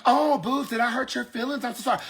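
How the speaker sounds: cooing mock apology; mock patronizing. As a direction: imitate someone talking down with pity. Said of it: "got the nerve to be patronizing".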